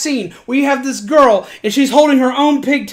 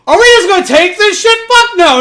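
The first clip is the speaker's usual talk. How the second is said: higher, quick mock outrage; rally the mob with a raised voice; indignant outrage; 7 semitones higher, 3 dB louder than usual. Rabbits rally to give chase.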